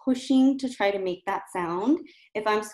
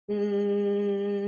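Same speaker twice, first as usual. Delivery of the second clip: steady held N sound; sustained N. Shown as a demonstration. Coach demonstrating a sustained N sound. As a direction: hold out a long buzzing N. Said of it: "hold out that N".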